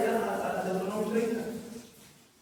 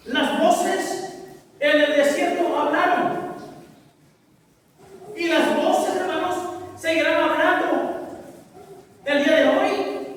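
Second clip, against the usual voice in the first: raised voice in separate, weighty phrases; impassioned preaching; 6 semitones higher, 7 dB louder, slower than usual. Real, not acted. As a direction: proclaim it phrase by phrase. Preaches at full force. (proclamación enérgica, frase por frase)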